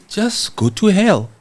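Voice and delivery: defiant dismissal. Retold or imitated. Streamer voicing a defiant leader telling critics off, slow and mocking. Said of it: "sometimes you just choose to be defiant and just say".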